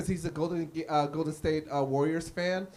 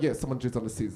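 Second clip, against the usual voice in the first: low casual stranger; 9 semitones lower, faster than usual. Retold or imitated. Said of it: "He's like".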